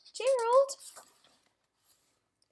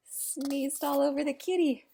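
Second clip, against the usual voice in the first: usual speaking voice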